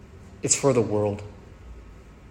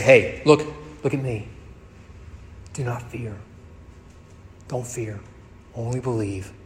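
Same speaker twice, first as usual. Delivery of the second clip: reassuring. Acted. Jesus reassuring frightened father.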